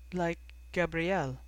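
lightly pensive aside; musing. A narrator's reading. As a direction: muse on it, half to yourself. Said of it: "she mused".

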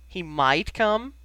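loud, slow disbelieving question, rising at the end; incredulous echo. A narrator's reading. Sirius echoing Ginny's words back, incredulous. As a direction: draw out MIGHT and COME in disbelief.

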